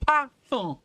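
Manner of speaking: very high, loud Canadian French "Parfum"; learner's punched-up Canadian French; Canadian French imitation. Shown as a demonstration. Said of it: "that's French in Canada".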